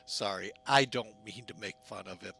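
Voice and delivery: ordinary talk, nothing marked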